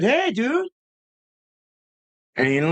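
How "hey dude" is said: higher called-out hey; yelling over. Retold or imitated. Retelling calling to the driver, a higher call-out. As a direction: call across the road to him. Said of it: "I yelled over and I said".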